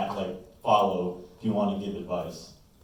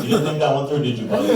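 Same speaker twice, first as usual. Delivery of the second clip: teasing; 4 semitones higher, 5 dB louder, faster than usual. Retold or imitated. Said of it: "And I'm like".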